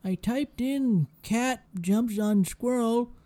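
put-on high, slow, quavering old person. Shown as a demonstration. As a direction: play a confused elderly internet user. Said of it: "I'm doing an old person voice".

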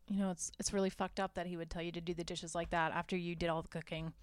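fast quiet egging-on, voice dropped low; sly hushed aside. Retold or imitated. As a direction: lean in and stir her up under your breath. Katherine Heigl quietly stirring Tiffany up.

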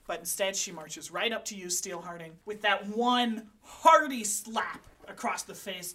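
usual speaking voice

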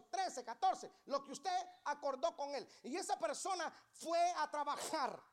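high, loud pulpit preaching; loud, high-pitched sermon delivery; fiery preaching. Acted. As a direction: preach it hard to the congregation. Preaching about unpaid workers.

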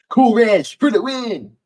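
high, slow cheering shout; excited cheer. Real, not acted. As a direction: hype it up like an announcer. Cheering for a favourite flavour.

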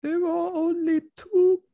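put-on very high, whiny French accent; a strained, Kermit-like French whine; French Jordan Peterson. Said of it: "I can't do it".

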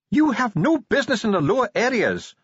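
high, loud dwarf snap; Ardent, snapping. A narrator's reading. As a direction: snap a sharp prohibition, pitched well up. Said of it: "snapped Ardent".

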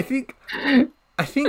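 squeaky high pitch. Real, not acted. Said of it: "I'm just kidding".

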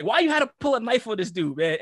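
mock angry yell at him; retold yell. Friend yelling at the narrator about the knife. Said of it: "He's like".

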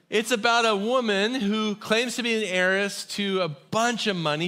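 ordinary delivery

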